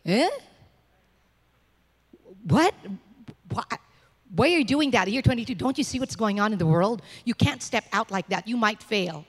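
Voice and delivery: lightly voiced scoffing onlooker; mock the doubters, half-hearted; mocking the world. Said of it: "the world's gonna look at us and say".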